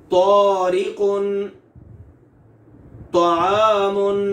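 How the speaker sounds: Arabic recitation with a heavy to; heavy emphatic to. Shown as a demonstration. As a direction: recite slowly and loud, full heavy to. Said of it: "ये पांच मिसालें हैं तो की".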